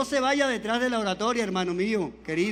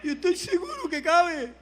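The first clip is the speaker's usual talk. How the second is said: retold tearful prayer; 3 semitones higher, 4 dB quieter, slower than usual. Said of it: "Esa madrugada llorándole".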